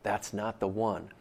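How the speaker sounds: slow, hushed voice in his ear; soft whisper. Retold or imitated. God whispering to Samuel, slow and hushed. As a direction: breathe it softly like a secret. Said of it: "God whispers in his ear and says".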